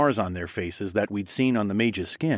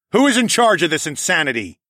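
loud, higher, indignant demand; raised voice; 8 semitones higher, 6 dB louder than usual. A narrator's reading. Hero confronting the mob, raised voice. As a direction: call out over a crowd. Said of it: "raised my voice".

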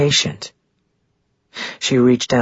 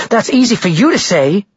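higher, louder angry retort; 10 semitones higher, 5 dB louder than usual. A narrator's reading. Maggie angry at Ren's male response, louder and higher. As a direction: snap back at him, higher and louder. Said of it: "angry at the typical male response".

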